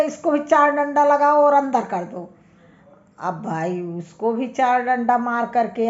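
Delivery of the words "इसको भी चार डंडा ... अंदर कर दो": angry judge order. Acted. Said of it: "जज को और गुस्सा".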